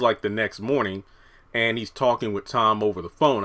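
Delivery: ordinary talk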